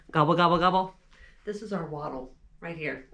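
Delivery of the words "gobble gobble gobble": loud, goofy gobbling turkey call; turkey gobble. Pretend. Song's ending turkey call, loud and goofy. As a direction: gobble like a big fat turkey. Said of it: "when I talk, I talk like this".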